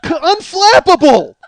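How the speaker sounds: high, loud, stretched-out exclamation; amazed laughing shout. Real, not acted. Comic marvelling at his co-worker's calm, laughing shout. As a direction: shout it slowly through laughter.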